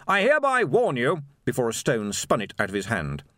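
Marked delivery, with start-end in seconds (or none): 0.1-1.2 raised, loud official warning through a megaphone; megaphone announcement. A narrator's reading. Captain warning a rioting crowd through a megaphone, loud. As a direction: call out formally over the crowd. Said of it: "raised the megaphone again".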